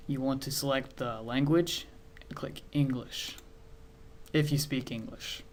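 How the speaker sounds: usual speaking voice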